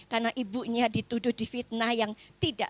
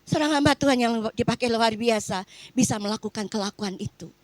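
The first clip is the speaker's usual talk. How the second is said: ordinary talk, nothing marked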